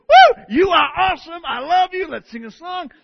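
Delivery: high, loud, overjoyed whoop; joyful praise. Retold or imitated. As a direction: burst out praising like David. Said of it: "he's praising the Lord, going".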